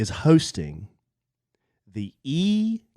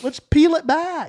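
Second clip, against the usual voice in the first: much higher, hammed-up talk-show host; mock therapist voice; 11 semitones higher, slower than usual. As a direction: play a pushy host, way up high. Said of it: "Why do I talk like this?".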